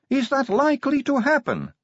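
very high-pitched questioning voice; innocent recruit. Acted. New recruit asking sergeant about locker pranks, high innocent voice. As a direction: pitch it right up, wide-eyed question.

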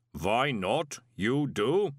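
measured, inhuman retort; reply evenly like a clay giant; matter-of-fact golem. A narrator's reading.

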